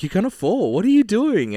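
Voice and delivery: high, loud mock alarm. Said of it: "She's like".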